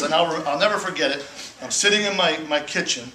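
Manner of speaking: ordinary delivery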